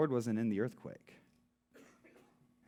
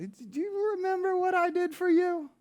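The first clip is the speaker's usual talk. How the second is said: much higher and louder, exasperated; impatient God; 8 dB louder than usual. Retold or imitated. Pastor playing a fed-up God, exasperated and loud. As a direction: scold him like a fed-up parent. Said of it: "If I was God, I would tell Elijah".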